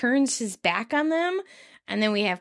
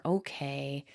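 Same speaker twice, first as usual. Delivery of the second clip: quiet, deflated okay; a small, resigned sigh of an okay; somber; 7 semitones lower, 8 dB quieter than usual. Retold or imitated. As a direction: let the word sink, defeated. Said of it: "with a somber".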